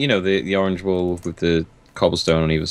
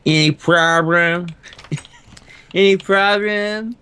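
high, drawn-out goofy question; put-on silly voice; 11 semitones higher, slower than usual. Co-host badgers after "any questions", silly high voice. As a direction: stretch it out slowly in a high silly voice.

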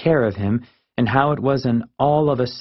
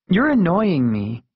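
lightly voiced annoyed friend, higher; a touch of exasperation, pitched up; Marisol; 7 semitones higher than usual.

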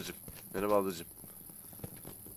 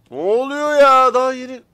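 startled cry; 7 semitones higher, 9 dB louder than usual. Real, not acted. Gamer startled by something happening on screen, loud high cry. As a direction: react as if caught totally off guard. Surprise event in game.

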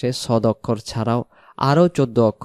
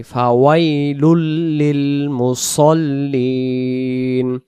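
ফাওয়াইলুল লিল মুসল্লিন with short vowels throughout; no drawn vowels, keep it moving; quicker, no stretching; much slower than usual. Shown as a demonstration. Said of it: "তাড়াতাড়ি".

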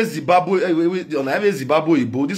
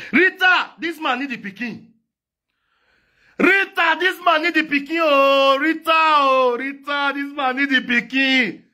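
calling out; 8 semitones higher than usual. Acted. Calling Rita repeatedly.

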